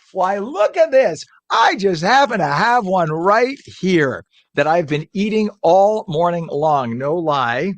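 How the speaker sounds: loud, slow, delighted showing off; high and loud with glee; excited. Real, not acted. Excited food segment host showing sandwich.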